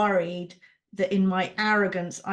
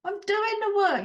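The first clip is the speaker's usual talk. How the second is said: high, exasperated insistence; frustrated; 11 semitones higher, 3 dB louder than usual. Real, not acted. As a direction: push back in real frustration. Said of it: "frustrated insistence".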